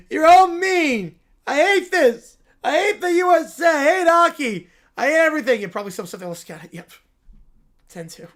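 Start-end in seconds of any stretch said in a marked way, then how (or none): 0.1-5.6 higher, louder bratty whine; whiny tantrum. Acted. Caillou whining at the mean Americans, loud tantrum. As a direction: stamp your words like a sulking kid.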